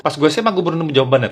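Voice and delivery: ordinary talk, nothing marked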